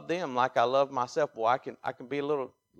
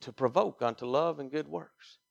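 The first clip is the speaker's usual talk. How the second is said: normal talking voice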